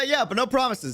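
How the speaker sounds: normal talking voice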